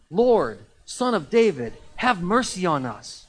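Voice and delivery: loud, insistent cry for mercy; acted roadside shout in a scripture reading; shouted plea. A narrator's reading. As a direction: shout it out over a crowd, slowing at the end. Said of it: "they shouted all the louder".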